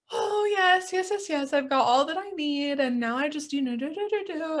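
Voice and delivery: high, airy mock-posh gushing; a hammed-up snob who has everything; hoity-toity. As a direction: play a smug self-satisfied manifester, lilting up high. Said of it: "hoity-toity way of being".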